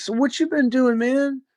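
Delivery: hammed-up curious friend; imitating Chip. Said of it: "And he goes".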